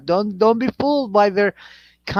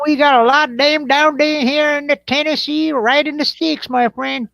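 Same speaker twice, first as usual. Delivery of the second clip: higher-pitched Tennessee backwoods voice; country Tennessee accent; 8 semitones higher than usual. Acted. Telling chat friends about the sticks, Tennessee accent. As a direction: ham up a Tennessee twang.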